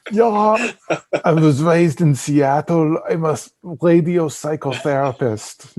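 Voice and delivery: mock German-accented action-star voice; Arnold Schwarzenegger. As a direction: put on a big hammed-up Schwarzenegger accent. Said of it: "my fake German accent".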